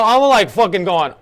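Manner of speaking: usual speaking voice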